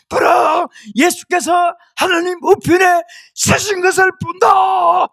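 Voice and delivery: high, loud, slow declaration; acted-out martyr's exultant shout; ecstatic shouted proclamation. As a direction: cry it out slowly, overcome with awe. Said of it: "소리치겠어요".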